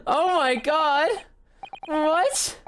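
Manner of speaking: high, astonished reaction; real disbelief. Real, not acted. Stunned by the animation.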